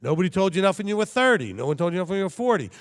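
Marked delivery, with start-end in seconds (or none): none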